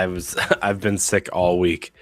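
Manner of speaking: usual speaking voice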